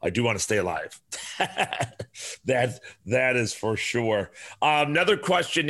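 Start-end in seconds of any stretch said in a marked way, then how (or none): 1.1-2.4 voiceless airy laugh; breathy laugh. Real, not acted. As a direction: laugh silently under the breath. Laughs at own line.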